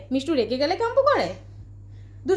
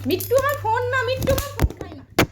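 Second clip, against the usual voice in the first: high voice for the parrot; 10 semitones higher than usual. Real, not acted. Telling parrot not to eat phone.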